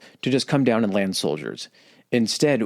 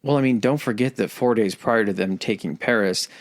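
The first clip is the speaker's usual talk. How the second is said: ordinary talk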